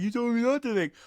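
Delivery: comic sulky comeback, pitched high; comic Rooster quote. Retold or imitated. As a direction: squeak it out like a sulky trainee. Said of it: "it's like".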